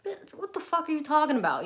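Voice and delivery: high, exasperated what-the-hell; incredulous disgust. Retold or imitated. Reacting to an old guy asking where she's from, high and incredulous. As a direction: let the disbelief spike up in pitch. Inner reaction to creepy question.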